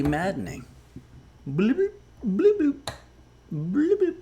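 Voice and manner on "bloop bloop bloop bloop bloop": very high-pitched mimicked device blips; quick little high rising bloops; imitated electronic bloops. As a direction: mimic an annoying alert noise, far above your voice. Imitates the maddening noise he hears.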